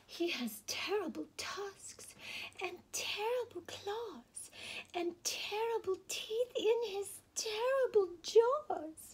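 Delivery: little mouse spooking the fox, rising in pitch; mouse describing monster. Acted. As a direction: build the terror on each terrible. Mouse describes scary Gruffalo.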